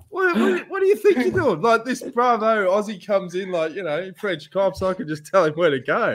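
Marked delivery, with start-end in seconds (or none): 0.1-1.6 mock indignant. Retelling Emu's confrontation.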